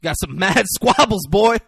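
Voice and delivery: loud, high swaggering taunt; big, punchy acted bravado; Denzel Washington. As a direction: crow it with mock swagger. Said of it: "Denzel walks up. He says".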